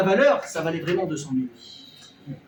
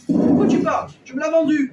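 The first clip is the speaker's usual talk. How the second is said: shouted retort; 7 semitones higher, 7 dB louder than usual. Acted. El jasid despertado le responde.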